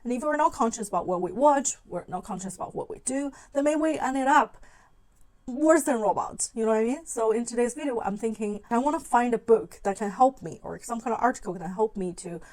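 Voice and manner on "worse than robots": ordinary talk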